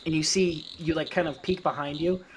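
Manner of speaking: normal talking voice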